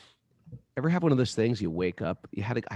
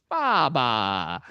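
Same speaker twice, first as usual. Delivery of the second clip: Indian sadhu voice; 3 semitones higher, 3 dB louder than usual. Retold or imitated. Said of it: "like an Indian sadhu voice".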